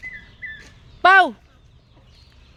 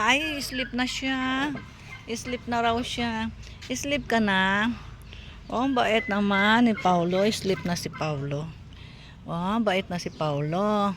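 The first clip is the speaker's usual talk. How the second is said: soothing coo; 3 semitones lower, 4 dB quieter, slower than usual. Real, not acted. Vlogger praising sleepy Paulo, soothing coo. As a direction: coo gently, stretching out the words.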